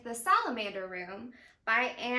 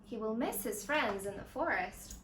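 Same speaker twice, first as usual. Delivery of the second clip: gentle read-aloud narration with voiced dialogue; storybook reading. A narrator's reading. Zoo educator reading a story to young viewers, gentle storytelling. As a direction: read it like a picture book for kids. Reads picture book aloud.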